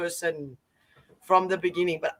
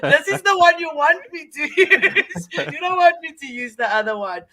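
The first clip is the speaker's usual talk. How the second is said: high, loud words through laughter; real giggling mock warning; laughing; 7 semitones higher, 5 dB louder than usual. Real, not acted. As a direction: joke while cracking up. Laughing over her loud voice.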